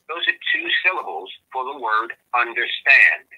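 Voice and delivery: normal talking voice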